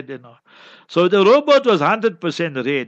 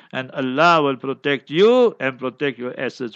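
usual speaking voice

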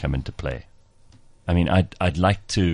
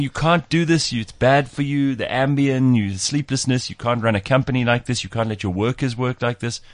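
higher put-on accent, scolding, easing down by the end; Arianna Huffington's accent; 7 semitones higher than usual. Host imitating Arianna Huffington scolding Elon about sleep, in a high mock accent. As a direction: lecture him in her mock accent. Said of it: "'cause she speaks like that".

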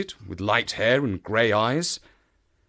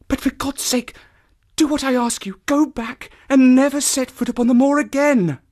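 high, urgent woman, breathy then louder; eager pleading; 13 semitones higher, 5 dB louder than usual. A narrator's reading. Woman begs a stranger to leave, eager. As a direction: plead desperately, building in force. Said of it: "She spoke in a low, eager voice".

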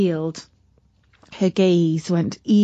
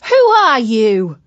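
loud; 7 dB louder than usual. Acted. Katy questions the bug.

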